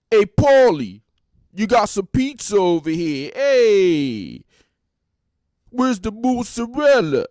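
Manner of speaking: loud, high Jersey wise guy voice; shouted mock wise guy; wise guy impression. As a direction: ham up a Jersey Shore tough guy calling out. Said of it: "it's full wise guy status".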